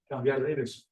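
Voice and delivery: normal talking voice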